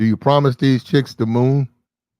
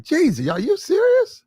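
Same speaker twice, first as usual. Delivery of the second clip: high squeaky disbelief, pitched way up; incredulous; 13 semitones higher than usual. Retold or imitated.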